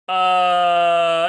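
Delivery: long, loud, drawn-out "uh"; stalling "uh". Acted. Cornered ambassador stalling for an answer, long stumped uh. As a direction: hold a blank, stumped uh.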